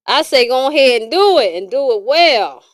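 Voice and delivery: loud, cheering the listener on; loud hype for the audience; loud encouragement. Real, not acted. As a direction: belt it out as a bold go-ahead.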